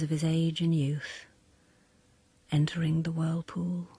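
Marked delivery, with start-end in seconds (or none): none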